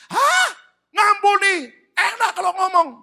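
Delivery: very high, loud, incredulous shout; outraged retort. Retold or imitated. Betrayed wife answering God's servant, outraged shout. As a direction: throw the order back in disbelief. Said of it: "penuh kemarahan".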